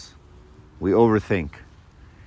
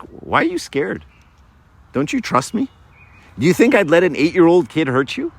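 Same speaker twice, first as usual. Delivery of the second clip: higher, incredulous offended father; mock-offended dad, pitched up; hurt dad; 6 semitones higher than usual. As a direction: sound wounded that he doubts you. Dad offended son doubts him.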